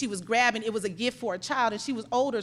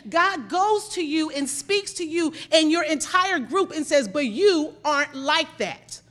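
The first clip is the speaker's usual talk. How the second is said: loud, raised-pitch sermon delivery; forceful preaching; 4 semitones higher, 5 dB louder than usual. Real, not acted. Preacher tells congregation God knows them in darkness, forceful preaching. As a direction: preach it hard, leaning on key words.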